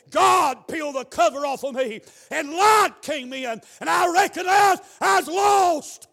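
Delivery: loud, exultant testimony shouted out; full-force preaching. Real, not acted. Preacher testifying to the night light came in, full-force preaching. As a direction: cry out thanks with mounting force.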